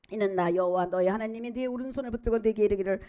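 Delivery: usual speaking voice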